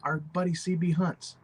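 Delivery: normal talking voice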